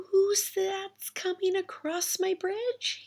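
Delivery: Little Ogre nervously calling to the goat; timid little ogre. A narrator's reading. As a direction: ask it carefully, small and high.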